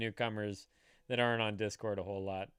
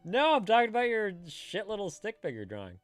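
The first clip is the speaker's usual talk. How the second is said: laughing; 10 semitones higher, 7 dB louder than usual. Real, not acted. Teasing friend over drawing.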